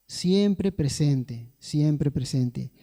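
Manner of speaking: "siempre presente" stretched out very slowly, then echoed; slow emphatic repetition. Acted. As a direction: linger on each word like a truth sinking in. Preacher dwells on God's presence. (muy lento y enfático, saboreando cada palabra)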